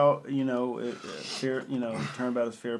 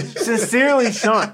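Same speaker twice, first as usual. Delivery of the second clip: mock formal sign-off; 9 semitones higher, 9 dB louder, slower than usual.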